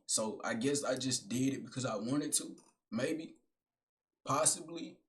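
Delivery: low, soft, deadpan second self; inner voice. Acted. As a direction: quiz yourself like a calm inner critic. Talking back to himself as alter ego.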